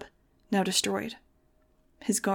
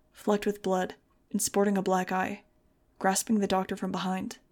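usual speaking voice

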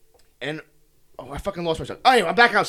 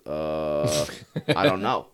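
low, drawn-out dopey uncertainty; clueless hesitation; 12 semitones lower, 3 dB quieter, much slower than usual. Retold or imitated. Airport worker asked who is in charge, mock-clueless. Acting out the clueless guy's answer.

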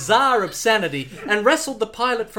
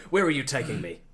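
Bond interrupting; 7 semitones lower, faster than usual. Acted. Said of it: "interrupted Bond".

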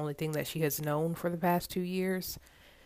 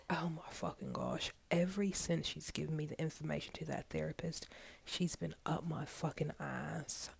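much softer, griping under her breath; muttered complaint; 8 dB quieter than usual. Acted. Sheila griping to herself about her boss, much softer. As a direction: grumble it so the boss can't hear.

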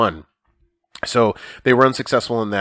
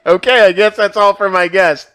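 laughing; 8 semitones higher, 6 dB louder than usual. Real, not acted. Host cracking up at kid.

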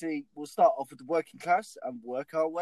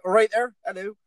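loud, higher-pitched Bristol greeting; Bristol accent; 4 semitones higher, 8 dB louder than usual. Retold or imitated. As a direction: call out a hearty Bristolian hello. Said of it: "Like Bristol".

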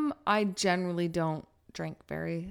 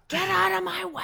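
mock shout; 12 semitones higher, 4 dB louder than usual. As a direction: bark it at people in the way. Mock yelling at slow strollers.

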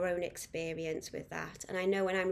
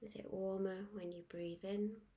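guided meditation; 9 dB quieter than usual. Acted. Guide inviting listeners to focus on the breath, soft meditation voice. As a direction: ease them gently into calm, letting each phrase settle.

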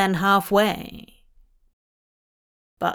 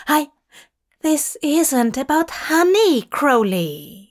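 flustered; 6 semitones higher, 3 dB louder than usual. Acted. Aziraphale flustered interrupting.

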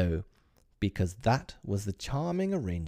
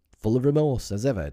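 earnest; 4 semitones higher, 7 dB louder, faster than usual. Acted. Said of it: "husband replies earnestly".

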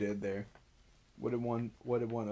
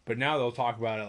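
ordinary delivery

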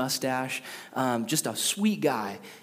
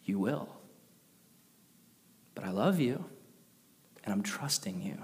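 softer, dropping to a whisper on trusting you; gentle, intimate reassurance, lightly voiced; voicing God tenderly; 5 semitones lower, 7 dB quieter than usual. God reassuring a doubting believer, soft and tender. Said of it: "God looks at us and he says".

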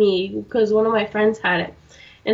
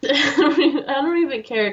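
usual speaking voice

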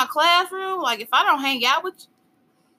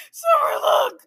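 excited; 12 semitones higher than usual. Real, not acted. Drink coming out her nose.

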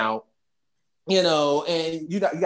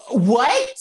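shocked what; 7 semitones higher, 6 dB louder than usual. Retold or imitated. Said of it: "she's like".

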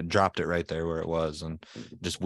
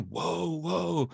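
high mock holler at moderate volume; pitched-up mock yell; imitated yelling; 8 semitones higher, 3 dB quieter than usual. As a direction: mimic someone yelling, without the volume. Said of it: "Why does he keep yelling".

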